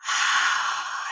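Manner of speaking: soft breathy exhale; calm sighing exhale; deep breath out. Shown as a demonstration. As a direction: let a long breath go. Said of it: "I placed my hand on a tree".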